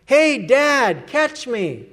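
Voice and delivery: small son shouting to his dad from the rocks; boy calling out. Retold or imitated. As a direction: call down like a young boy leaping. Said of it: "he heard a voice above him say".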